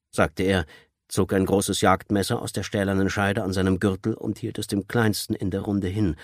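usual speaking voice

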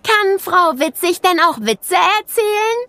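hoch und etwas lauter, verschmitzt; neugieriges Mädchen. A narrator's reading. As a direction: frag mit verschmitzter Neugier. Clara fragt neugierig. (Clara fragt nach Witzen: hoch, neugierig)

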